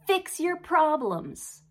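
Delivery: hammed-up scolding, dragging out fix your problems; mock stern God. Speaker mimics her old misreading of God as a mild mock scold. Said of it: "I absolutely read".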